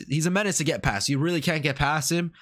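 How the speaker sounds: ordinary talk, nothing marked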